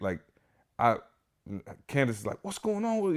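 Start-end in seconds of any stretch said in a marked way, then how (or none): 2.4-3.1 high, mildly imitated alarmed question; a light high-pitched imitation of the panicking wife; panicked wife. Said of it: "she started panicking".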